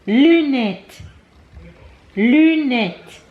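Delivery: Lunette with French rounded u, high and falling; French "Lunette", drawn out in a high teaching voice; teaching French word. Shown as a demonstration. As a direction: model "Lunette" for a toddler, stretched and loud. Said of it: "but in French it's".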